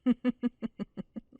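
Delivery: smug teasing laugh; amused laugh. Acted. Vampire mocking her captive's hunger, smug laugh.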